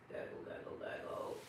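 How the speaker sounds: Santa's stomach rumbling, whispered; tummy gurgle. Acted. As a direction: breathe out a gurgling tummy noise. Imitating tummy noise.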